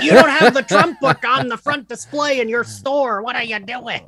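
mock Florida man, high and slowed, laughing through it; a guy indignant at the store owner, voiced laughing. Ranting Florida man, high and laughing. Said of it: "type of Florida man that would be like".

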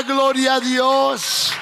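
long-held ALGUIEN, then a slow shout dropping in pitch; shouted call. Real, not acted. Preacher demanding the church shout glory to God, drawn-out shout. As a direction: stretch it into a rousing shout. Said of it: "Alguien grita".

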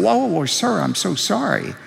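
mildly acted startled attendant. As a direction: apologize as if caught off guard. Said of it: "the attendant was startled".

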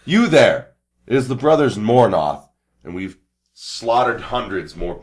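high, loud heroic proclamation; commanding hail. Acted. Brothers announce themselves to a kneeling stranger, loud hail. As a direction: announce yourselves loudly and grandly. Player hails stranger in character.

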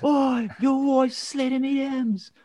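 put-on high, slow, overblown Irish accent. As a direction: belt it in a hammy Irish accent. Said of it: "I can't even do an Irish accent".

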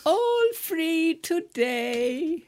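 high, loud villain call; child catcher. Retold or imitated. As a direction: lure the kids with a sing-song cry.

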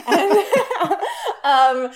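high giggling laugh. Real, not acted. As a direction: burst out laughing. Laughs at mother's joke.

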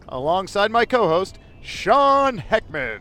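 loud, high, slow show announcer. Pretend. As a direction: boom it out like a TV host opening the show. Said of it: "this is my announcer voice".

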